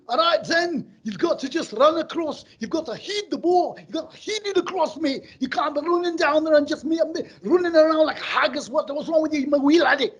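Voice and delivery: loud, high Scottish football-coach rant; hammed-up Scottish half-time shouting. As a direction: yell it in a thick Scottish accent. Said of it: "He was Scottish ... Scottish coach would be like".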